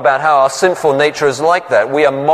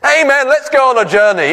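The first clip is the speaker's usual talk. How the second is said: much higher, hammed-up joyful amen; mock jubilant; 9 semitones higher than usual. Preacher mocks an overeager believer, high and jubilant. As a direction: play a jumping, overeager believer. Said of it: "jumping up and down and saying".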